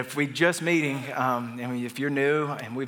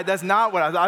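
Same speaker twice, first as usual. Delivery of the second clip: ordinary talk, nothing marked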